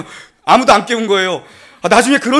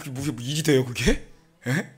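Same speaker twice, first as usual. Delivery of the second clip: ordinary talk, nothing marked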